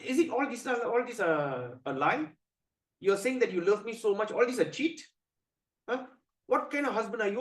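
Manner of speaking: high, wounded accusation; angry wife. Acted. Parvati doubting her husband's love, angry reproach. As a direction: accuse the husband of cheating, indignant. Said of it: "she gets very angry".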